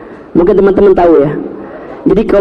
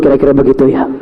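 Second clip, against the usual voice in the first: ordinary delivery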